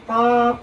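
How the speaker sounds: over-heavy পাপ; heavy vowel. Shown as a demonstration. Teacher repeats the faulty sound, heavy vowel. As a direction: say পাপ thick, with a full dark vowel. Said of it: "এটা হলো মোটা".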